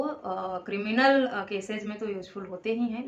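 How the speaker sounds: ordinary talk, nothing marked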